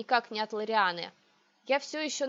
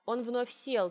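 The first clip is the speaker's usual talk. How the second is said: ordinary delivery, nothing marked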